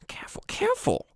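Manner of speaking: high whispery goblin voice; high, whispered old goblin warning; old goblin cautioning. Acted. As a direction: fuss over a patient in a high whisper. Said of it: "the old, the older one".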